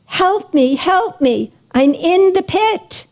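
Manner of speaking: a storyteller's mild cry for help; calling out for help. A narrator's reading. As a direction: call up out of a pit, pitch rising at the end. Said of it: "the man calls out".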